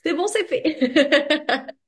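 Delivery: slow, laughing, high; laughing mock announcement. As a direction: drawl it out laughing, teasing. Jokes about the photos she was sent. (annonce moqueuse en riant, lente)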